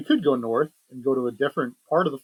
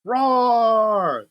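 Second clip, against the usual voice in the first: caveman roar; 6 semitones higher, 4 dB louder than usual. Acted.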